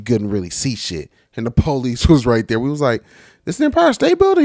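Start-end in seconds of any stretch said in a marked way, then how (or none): 3.5-4.4 high, loud disbelieving question; mock incredulous question. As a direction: squeak it out in mock shock. Tourists asking cop, incredulous.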